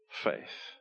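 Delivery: a breathy whisper on the last word. Real, not acted. Preacher whispers faith about his daughter's simple trust. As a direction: drop to a hush on faith.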